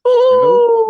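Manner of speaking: high loud cheering woo; excited whoop. Real, not acted. Cheering the guest's arrival.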